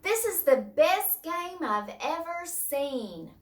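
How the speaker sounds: high, drawn-out excited reading; acted-out excitement for the class; excited exclamation. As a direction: exclaim it slowly, pitched right up. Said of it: "I am getting excited".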